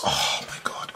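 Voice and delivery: breathless awed whisper; unvoiced fan whisper; excited whisper. Real, not acted. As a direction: whisper it in thrilled disbelief. Awestruck at Logan Paul.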